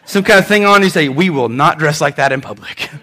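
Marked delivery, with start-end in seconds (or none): 1.1-2.9 stern parent. Acted. Parent correcting a child's outfit.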